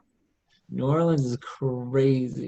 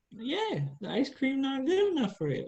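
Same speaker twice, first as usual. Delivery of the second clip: higher, teasing local woman; a hint of a sassy New Orleans lady; nosy stranger; 13 semitones higher, 3 dB quieter than usual. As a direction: insist playfully. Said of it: "I was like".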